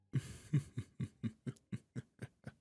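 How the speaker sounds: long staccato villainous chuckle; slow self-satisfied laugh trailing away; gloating chuckle. Acted. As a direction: chuckle on and on, pulse by pulse. Magician gloats over transformed pet.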